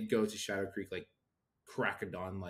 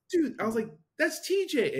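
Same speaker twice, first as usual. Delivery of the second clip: excited recognition; 6 dB louder than usual. Retold or imitated.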